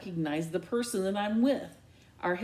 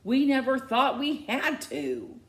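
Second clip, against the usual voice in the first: higher, louder outburst; exploding; 6 semitones higher, 5 dB louder than usual. Acted. Mom exploding at her daughter. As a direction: blow up at her. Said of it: "my mother explodes".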